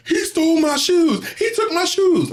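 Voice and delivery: high, slow crying whine; whining nephew. Retold or imitated. Crying nephew complains to grandmother, high slow whine. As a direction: whine it like a teary kid. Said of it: "he got tears in his eyes".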